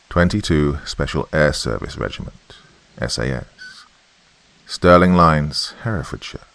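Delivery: ordinary delivery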